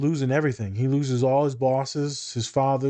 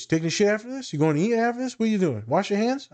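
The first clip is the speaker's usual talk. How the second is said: rapid questioning; 8 semitones higher, faster than usual. Retold or imitated.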